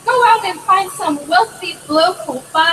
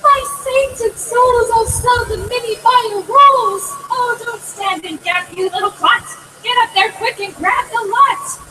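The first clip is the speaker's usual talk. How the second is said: excited yell; 8 semitones higher than usual. A narrator's reading. Mother yelling at Jack, high and amazed. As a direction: yell it out amazed, much higher. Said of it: "she yells out".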